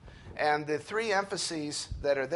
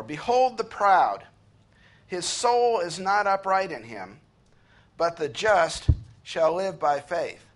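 slow, louder, weighty reading of the verse; solemn scripture reading; 3 semitones higher, 6 dB louder, slower than usual. Acted. Preacher reading the key verse aloud to his congregation, slow and solemn. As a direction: lay each phrase down slowly like holy writ.

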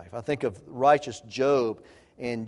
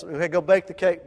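ordinary talk, nothing marked

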